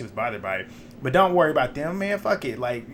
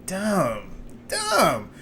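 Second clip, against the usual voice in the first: exasperated dumb; 4 semitones higher than usual. Retold or imitated. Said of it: "I'm like".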